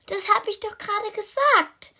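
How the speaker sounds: high, loud protest; exasperated high cry; indignant call. Acted. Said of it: "rief Blackie".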